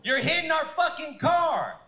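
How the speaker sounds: high, loud mock road-rage shout; imitating an outraged passenger shouting; angry yell. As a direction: yell at the other driver. Wife yells at other driver.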